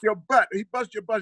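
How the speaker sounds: ordinary talk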